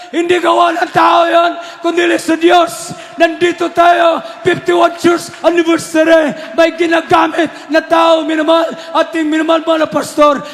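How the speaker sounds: high, loud and slowed, pounding out each phrase; full-throated pulpit preaching; fiery preaching. Acted. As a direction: preach it full force to the church.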